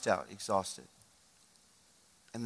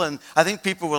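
ordinary delivery

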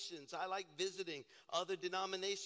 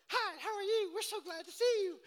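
high, louder, chirpy church greeter; overly friendly greeter; 13 semitones higher, 7 dB louder than usual. Retold or imitated. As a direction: gush like an overeager church greeter. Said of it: "these people just like".

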